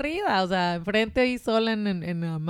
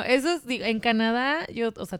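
usual speaking voice

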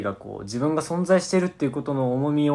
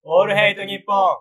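radio announcer; 8 semitones higher, 7 dB louder than usual. Acted. Radio show title call.

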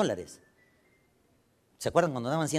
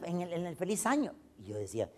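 usual speaking voice

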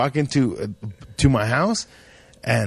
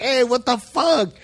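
high, slow yelp of protest; pained protest; 9 semitones higher than usual. Retold or imitated. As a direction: cry out as the lemon stings. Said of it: "I was like".

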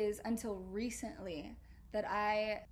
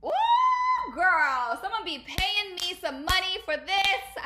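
sassy; 9 semitones higher, 11 dB louder, slower than usual. Real, not acted. Sassy exclamation after whisper.

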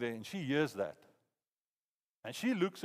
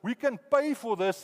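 eager claim; 6 semitones higher, 6 dB louder than usual. Retold or imitated. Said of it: "we wanna stand in place here and go".

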